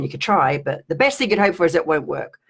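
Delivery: ordinary talk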